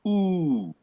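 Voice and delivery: long "oo" held high, then sliding down; drawn-out high tone. Shown as a demonstration. Teacher demonstrating accented sounds, long high sliding "oo". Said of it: "you go up".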